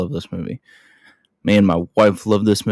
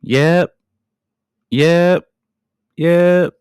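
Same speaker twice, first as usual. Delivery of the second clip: repeated yeps, each one higher and louder; mechanic saying yep; 7 semitones higher, 3 dB louder, much slower than usual. Retold or imitated. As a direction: imitate the mechanic's yeps climbing upward. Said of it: "the mechanic is like".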